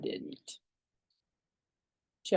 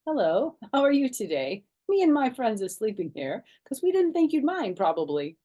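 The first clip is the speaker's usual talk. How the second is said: put-on sheepish child; 5 semitones higher, 6 dB louder than usual. A narrator's reading. Caught child explains to mother.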